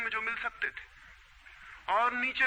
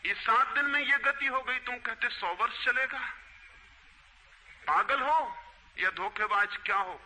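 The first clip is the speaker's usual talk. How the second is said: angry king; 6 semitones higher than usual. Acted. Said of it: "उन्होंने कहा".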